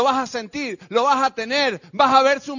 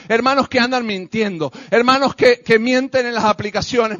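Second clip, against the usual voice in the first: ordinary delivery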